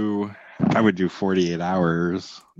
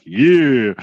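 loud, raised one-word echo of a movie henchman; big-guy echo; 4 semitones higher, 8 dB louder than usual. Acted. Co-host mimics film's bigger guy to podcaster, loud echo. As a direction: punch it out like the big sidekick backing his partner.

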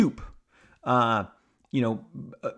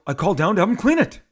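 higher, louder, rushed retort; exasperated; 7 semitones higher, 5 dB louder, faster than usual. Acted. Shatner tells us he already called down, exasperated. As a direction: imitate a fed-up guest, fast and raised. Retold exasperated guest answers.

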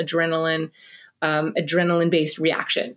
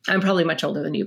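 normal talking voice

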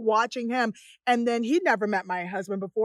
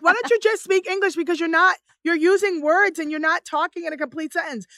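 higher, louder mock scolding; imitated exasperated kids; 6 semitones higher, 5 dB louder than usual. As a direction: complain like embarrassed kids. Exasperated kids scolding their mother.

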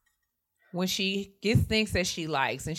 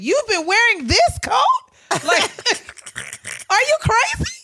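high, slow disbelief, much louder; incredulous; 7 dB louder than usual. Retold or imitated. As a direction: squeal in disbelief at mom's old coat. Incredulous at mom's old coat.

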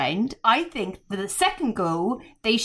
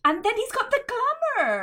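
gushing; 12 semitones higher than usual. Real, not acted. Gushing over [name].

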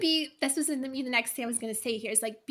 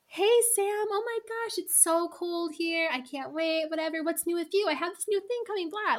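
bright, much higher, casual catch-up; chatty friend voice; 5 semitones higher than usual. Shown as a demonstration. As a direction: gush like you just ran into a friend. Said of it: "as if you were bumping into a good friend at the grocery store".

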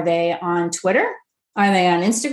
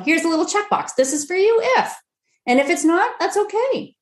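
friendly marketer; 9 semitones higher than usual. Retold or imitated. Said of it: "approach it with service of like".

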